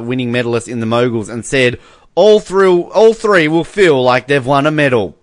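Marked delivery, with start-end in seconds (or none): 2.1-5.2 imitated commentator, raised and louder; Dirk Nannes. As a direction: proclaim it like an excited TV commentator, mockingly. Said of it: "great line of commentary there by Dirk Nannes".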